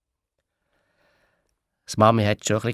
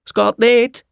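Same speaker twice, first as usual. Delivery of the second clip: gestöhnt; 10 semitones higher, 6 dB louder, slower than usual. Acted. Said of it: "hat Clara gestöhnt".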